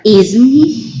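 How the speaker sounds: "Isn't he" with a rise on "he"; rising intonation. Shown as a demonstration. Teacher repeating the France tag question, rising intonation. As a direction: raise "he" at the end to check. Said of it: "the intonation goes up".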